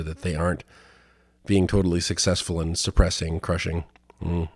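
ordinary delivery

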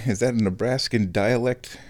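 ordinary talk, nothing marked

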